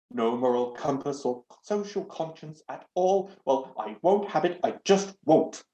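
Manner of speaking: huffy, prim doorknob lecturing; loud, scandalised talking knob; indignant doorknob. Acted.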